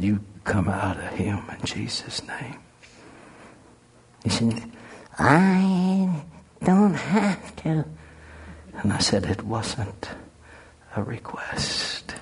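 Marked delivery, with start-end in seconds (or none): 0.0-2.6 voiceless command said right into his face; whispered command. Retold or imitated. Close-up command to demon. 5.2-7.8 high, slow, taunting demon; defiant demon voice. Retold or imitated. Demon answering, retold. 9.3-12.1 soft, slow, firm retort; quiet menace. Retold or imitated. Calm retort to demon.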